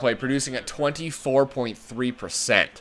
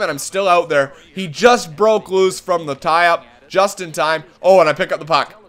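normal talking voice

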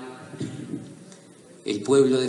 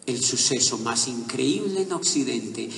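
ordinary delivery

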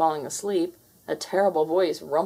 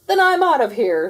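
hasty retreat; 7 semitones higher, 9 dB louder than usual. Acted. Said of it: "said Garfield".

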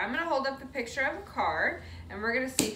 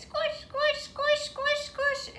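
much higher, playful squeaky chant; squeaky squish voice; slower than usual. Shown as a demonstration. Teacher playing squish game with kids, high squeak. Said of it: "Can you squish your hands".

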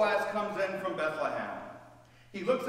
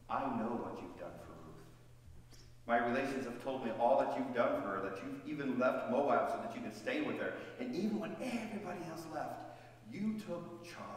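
solemn praise; 7 semitones lower, 6 dB quieter than usual. Acted. Boaz earnestly praising Ruth's loyalty, low and soft. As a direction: lower and softer, earnest.

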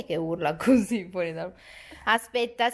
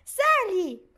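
high coaxing command; 10 semitones higher, 3 dB louder than usual. Real, not acted. Mother urging her child to climb, high encouragement.

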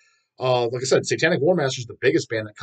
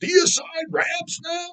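much higher, slow squeaky mock voice; mocking clueless commenters; much slower than usual. Vlogger parodying clueless metal commenters, high slow squeaky mockery. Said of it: "all this stupid shit people are saying".

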